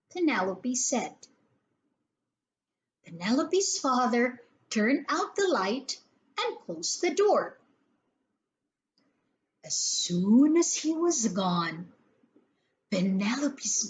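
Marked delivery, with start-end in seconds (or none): none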